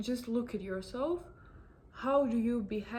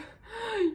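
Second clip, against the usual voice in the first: high burst of laughter; 12 semitones higher than usual. Real, not acted. Laughs at mock insult.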